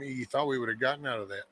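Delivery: ordinary delivery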